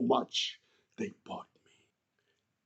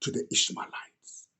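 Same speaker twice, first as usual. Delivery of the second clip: low, hushed and conspiratorial; scheming whisper; 6 semitones lower, 6 dB quieter than usual. Acted. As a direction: murmur it like a secret plan. Brothers decide to sell Joseph.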